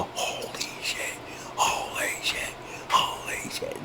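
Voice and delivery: whisper. Retold or imitated. Shocked thought, excuse my language.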